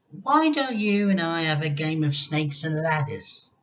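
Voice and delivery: a mock-sweet granny greeting; imagined kindly grandma greeting George. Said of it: "had she smiled at George and said".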